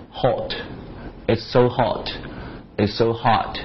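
hot with a wide ah, slow; so hot with a broad open vowel; American open o. Shown as a demonstration. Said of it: "这种感觉".

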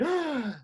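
a quick, shocked intake of breath; the amygdala sensing danger, one sharp gasp. Shown as a demonstration. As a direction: gasp as if suddenly startled by danger. Demonstrates amygdala alarm.

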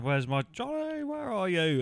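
half-hearted high mock wail; mock calling out. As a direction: imitate someone calling for a lost partner. Re-enacting the character calling for Johnny.

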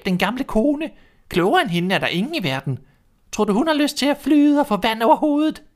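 high, bossy hen voice; a fussy hen nagging; hen's voice. A narrator's reading. Hen nagging the restless duckling, high and bossy.

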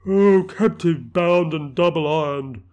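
slow, loud anguished cry. Acted. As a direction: wail it like a chained spirit. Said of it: "cried the phantom".

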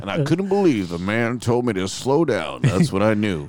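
mock Republican speech. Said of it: "The right wing would love that".

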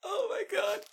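high, wheezing, helpless laughter; can barely get it out for laughing; real laughing fit; crying with laughter. Real, not acted.

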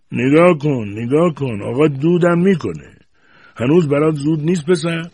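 loud, high mocking jeer. A narrator's reading. Grandfather ridiculing the smoking boy, loud mocking jeer. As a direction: jeer at the boy, pointing him out. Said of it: "به مسخره گفت".

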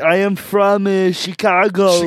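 high mock Austrian accent. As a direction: put on a thick Austrian accent.

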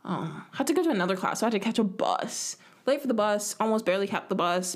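ordinary talk, nothing marked

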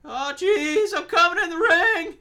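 high, nervous cartoon teen; Morty voice. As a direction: squeak it out like Morty. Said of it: "a lot of Morty from Rick and Morty".

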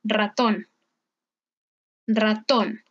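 "ratón" opening on a trilled R; careful "rratón" with a vibrating R; strong Spanish R. Shown as a demonstration. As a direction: roll the first sound of "ratón". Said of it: "una R simple al comienzo".

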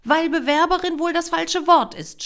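loud, high angry comeback; hurled retort. A narrator's reading. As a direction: fling it at her. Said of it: "schleuderte Maria ihr entgegen".

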